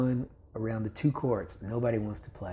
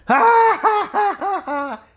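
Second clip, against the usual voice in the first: loud, much higher ha-ha-ha jeer; mocking laugh; 12 dB louder, much slower than usual. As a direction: laugh right in his face. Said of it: "He just starts laughing".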